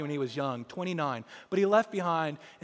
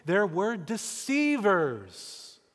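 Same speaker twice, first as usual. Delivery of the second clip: very slow warning; 4 semitones higher, 4 dB louder, much slower than usual. Real, not acted. Warning about deceivers.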